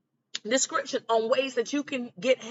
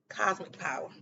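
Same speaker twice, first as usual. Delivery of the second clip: ordinary delivery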